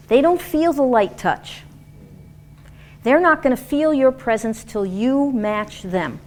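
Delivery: normal talking voice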